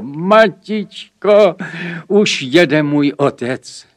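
high, loud, drawn-out call to mother; child's ringing shout of news; excited cry. A narrator's reading. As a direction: cry out slowly and excitedly like a little boy. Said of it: "vykřikl".

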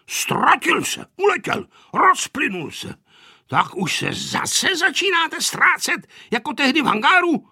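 high-pitched, exasperated grandfather; angry scolding. A narrator's reading. Grandfather scolding children for getting lost, angry. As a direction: scold them in a high, worked-up voice. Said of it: "zlobil se dědeček nahlas".

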